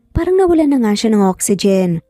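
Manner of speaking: ordinary delivery, nothing marked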